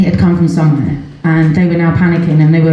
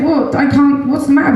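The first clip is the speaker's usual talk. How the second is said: startled reply; 8 semitones higher than usual. Retold or imitated. Mother's alarmed reply to son.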